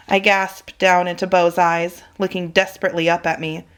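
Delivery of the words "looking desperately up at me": plain reading voice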